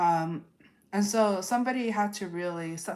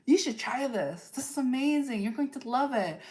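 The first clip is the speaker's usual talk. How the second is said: higher, sweet coaxing invitation; playfully enthusiastic friend urging a taste; loving encouragement; 6 semitones higher than usual. Retold or imitated. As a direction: coax them gently into trying it. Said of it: "came in all lovingly".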